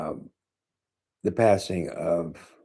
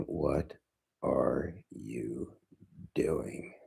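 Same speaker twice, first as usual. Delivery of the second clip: softer, slower, drawn-out question; quiet repeat; 4 semitones lower, 9 dB quieter, much slower than usual. Retold or imitated. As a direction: let the question sink in quietly.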